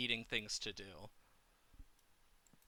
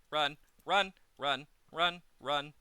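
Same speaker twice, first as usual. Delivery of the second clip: urgent loud chant of run; panicked, pushing herself to flee; being chased; 5 semitones higher, 8 dB louder than usual. Real, not acted. Fleeing a mob in game.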